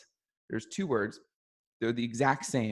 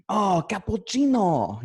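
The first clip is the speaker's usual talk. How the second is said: high, slow, sing-song "Oh, cappuccino" in a mock Italian voice; hammed-up Italian, the penny finally drops; Italian sudden recognition; 8 semitones higher, 6 dB louder, slower than usual. Italian barista finally getting the tourist's order, mock delight. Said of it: "all of a sudden it clicks and goes".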